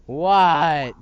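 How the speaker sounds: loud shocked what; blurt, stunned. Acted. Shocked outburst.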